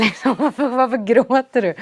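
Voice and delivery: laughing disbelief. Retold or imitated. Retells staring at crying teacher.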